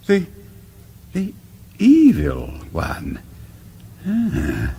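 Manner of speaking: halting, very slow, stumbling over the words; slow realisation, falling into fear; the penny drops in horror; dawning dread. Acted. Realises the evil one comes.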